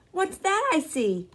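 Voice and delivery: playful bus driver. Acted. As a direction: pull a coin like a stage magician. Mister Dennis magic trick.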